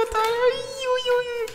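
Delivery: mocking. Mocking the loser.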